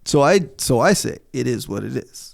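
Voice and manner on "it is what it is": softly dismissive "it is what it is". Retold or imitated. Co-host quotes himself answering his wife, dismissive. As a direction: wave it away quietly. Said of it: "or known as dismissing".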